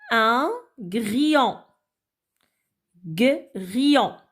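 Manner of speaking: slow grillon, broken into isolated beats; syllable by syllable. Shown as a demonstration. Teacher cutting words up for children, grillon slowly broken up. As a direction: split grillon apart with gaps. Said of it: "découper les mots en syllabes".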